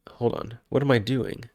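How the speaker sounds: ordinary delivery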